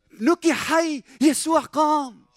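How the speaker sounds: high, loud, drawn-out announcement; triumphant proclamation. Acted. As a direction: proclaim the good news loudly and high. Proclaims the resurrection news. (إعلان منتصر بصوت عالٍ)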